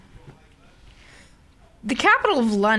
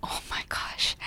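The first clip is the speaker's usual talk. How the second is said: voiceless, hushed reaction; whispered aside; 12 dB quieter than usual. Real, not acted. Reacting to co-host's love of afternoon tea, whispered. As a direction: whisper it under your breath.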